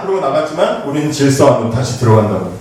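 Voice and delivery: normal talking voice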